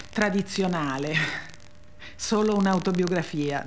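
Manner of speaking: normal talking voice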